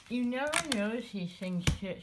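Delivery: talking with a tool in her mouth; words blurred by a tool held between teeth; mouth full. Real, not acted. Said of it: "Sorry, I had my slice tool in my mouth".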